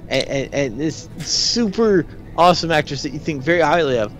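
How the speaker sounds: ordinary delivery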